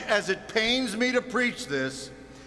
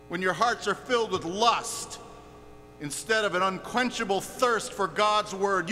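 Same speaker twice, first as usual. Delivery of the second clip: ordinary talk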